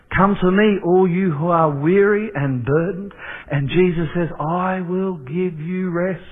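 preaching scripture. Acted. Pastor voicing Jesus' invitation to the weary, proclaimed.

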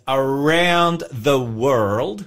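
drawn-out, slow mock-American accent. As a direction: put on a dodgy American newsreader twang. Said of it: "You just said the very American world".

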